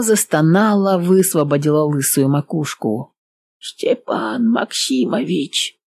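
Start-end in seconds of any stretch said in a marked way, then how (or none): 3.6-5.8 put-on weak, lisping old man calling out; a dying old man lisping a name. A narrator's reading. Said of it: "прошепелявил старик".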